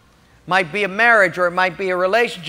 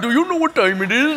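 quicker imitated East Indian accent; 4 semitones higher, faster than usual. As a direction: East Indian accent, higher-pitched and brisk. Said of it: "from an East Indian accent".

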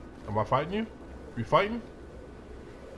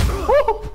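high, loud "woo"; excited whoop; 7 dB louder than usual. Real, not acted. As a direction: whoop mid-fight. Fighting in the game.

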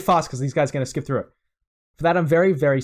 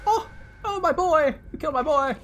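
mock grief; 12 semitones higher than usual. Mock grief over dead creature.

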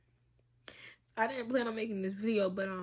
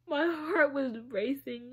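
slow, tearful and choked up; real crying while recalling a frightening moment; 3 semitones higher, 4 dB louder than usual. Real, not acted. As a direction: push the words out through real tears. Tearful account of childhood abuse.

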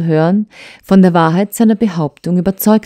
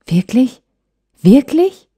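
rising, higher question, more insistent the second time; searching disbelief; 9 semitones higher, 3 dB louder, slower than usual. A narrator's reading. Wife questions his answer, searching disbelief. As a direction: press for the truth with rising pitch. Anxious repeated question to husband.

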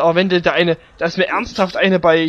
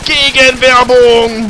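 loud call; 5 semitones higher, 6 dB louder, much slower than usual. Acted. Gaming, calling out loudly.

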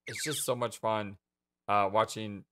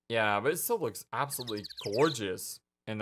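ordinary talk, nothing marked

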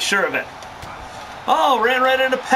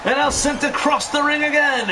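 excited live play-by-play, raised voice; wrestling commentator; 3 semitones higher than usual. Real, not acted. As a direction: call the action as it happens.

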